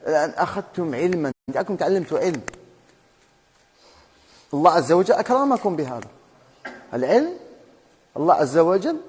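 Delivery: usual speaking voice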